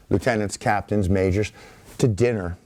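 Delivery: usual speaking voice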